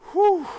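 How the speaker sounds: loud, high exhaled whew; real catching-breath whoop of relief; winded whew. Real, not acted. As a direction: blow out a big breath after running. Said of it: "a little out of breath here".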